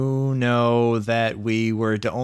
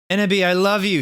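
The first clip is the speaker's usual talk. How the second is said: high, louder lovesick cry; sad mock shout; 7 semitones higher, 4 dB louder than usual. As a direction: declare love in despair. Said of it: "he shouted sadly".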